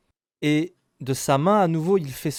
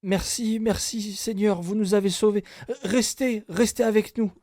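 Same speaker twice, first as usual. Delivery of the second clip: grateful villagers; 11 semitones higher, 5 dB louder than usual. Acted.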